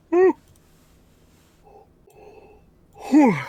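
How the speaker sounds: yawning. Real, not acted. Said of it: "I'm yawning all of a sudden".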